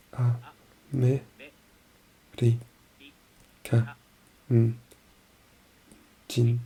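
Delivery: very slow A-me-ri-ka-n-jin, each syllable split. Shown as a demonstration. Learner breaking the word into syllables, very slow. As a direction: spell it out slowly, one syllable at a time. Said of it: "America-jin".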